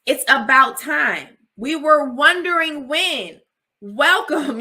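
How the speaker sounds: mock welcoming crowd. Friends greeting her finally asking for help, teasing and loud. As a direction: play the friends who saw it coming, drawn out. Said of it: "People were like".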